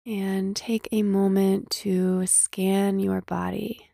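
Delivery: slow meditation voice with long pauses; guided meditation. Acted. Host leading listeners to scan body and breath, guided meditation. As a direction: gently guide listeners into stillness, unhurried.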